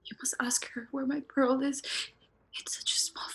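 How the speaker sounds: fast hushed urgent whisper. Acted. Ghost girl urging Luis.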